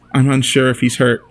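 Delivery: usual speaking voice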